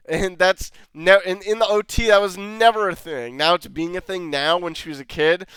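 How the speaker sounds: ordinary talk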